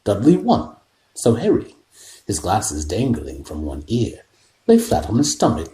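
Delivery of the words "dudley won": usual speaking voice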